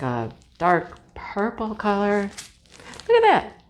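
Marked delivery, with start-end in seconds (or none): 3.1-3.6 high squealed exclamation; excited squeal. Real, not acted. Squealing at a pretty paint color, excited. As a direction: squeal it out suddenly.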